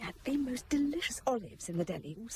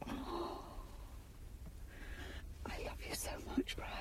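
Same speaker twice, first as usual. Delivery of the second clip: tearful whisper; 8 semitones higher, 11 dB quieter than usual. Acted. Wife confessing love, tearful.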